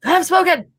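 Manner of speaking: high, hammed-up echo of a pompous orc; mock orc proclamation. Listener mockingly echoes the orc captain's line. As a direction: throw the line back in a jokey, grand mock voice.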